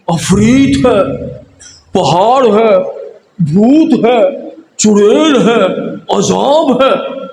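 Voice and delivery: slow, drawn-out dread over the snake; dramatic awe. Acted. Preacher describing the snake with slow awe. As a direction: paint each horror word slowly.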